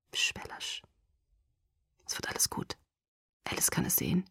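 hushed calming whisper; a gentle whispered comfort; soothing whisper. Acted. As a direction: whisper reassurance softly. Soothing the frightened girl.

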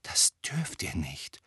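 slow, hushed whisper; soft, voiceless warning. A narrator's reading. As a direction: whisper it as an urgent plea. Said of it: "flüsterte Hermine".

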